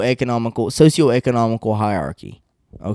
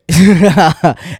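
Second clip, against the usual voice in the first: laugh; 3 semitones higher, 4 dB louder than usual. Real, not acted. Laughs at his own joke.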